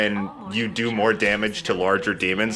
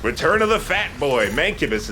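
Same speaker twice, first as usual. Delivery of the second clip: excited announcement; 7 semitones higher than usual. Real, not acted. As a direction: announce it like a wrestler's entrance. Announcing a returning enemy.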